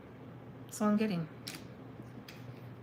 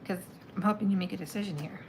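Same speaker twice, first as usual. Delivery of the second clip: ordinary talk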